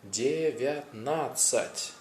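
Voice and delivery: Девятнадцать drawn out, every syllable and consonant sounded; slow full Russian. Shown as a demonstration. Teacher modelling a long number for learners, slowly. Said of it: "That's already four syllables".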